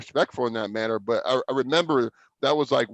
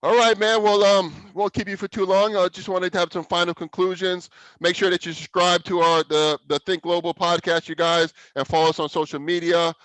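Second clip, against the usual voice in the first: podcast outro; 4 semitones higher, 3 dB louder than usual. Acted. Host wraps up the podcast.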